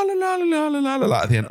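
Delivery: high chirpy la la tune; sing-song la la. Retold or imitated. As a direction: sing it like a car chime. Imitating car key-out tune.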